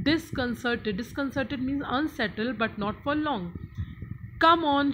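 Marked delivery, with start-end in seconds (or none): 4.4-4.9 higher, much louder rallying shout; rally the guests with a shout; excited shout. Acted. William rallying his guests to storm the house, excited shout. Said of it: "shouted William excitedly".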